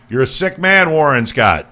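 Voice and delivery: playful accusation; mock outrage. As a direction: call him out for laughs. Teasing a former guest.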